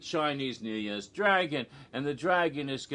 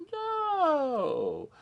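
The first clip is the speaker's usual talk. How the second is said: long, high drawn-out "no"; hammed-up innocence, stretched high; mock-innocent denial. Mock denial of meth in cupcakes.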